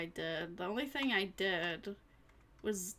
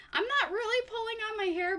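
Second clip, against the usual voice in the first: high quick aside; 13 semitones higher, 5 dB louder, faster than usual. Real, not acted.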